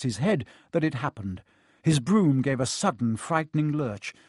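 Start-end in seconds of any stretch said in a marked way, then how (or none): none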